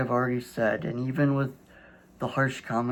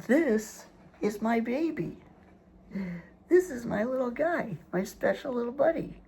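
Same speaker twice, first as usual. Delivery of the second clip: doting pet voice; 8 semitones higher than usual. Real, not acted. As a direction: coo over the little dog. Shows his dog on camera.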